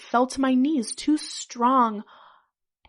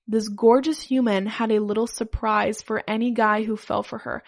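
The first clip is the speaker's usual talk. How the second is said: usual speaking voice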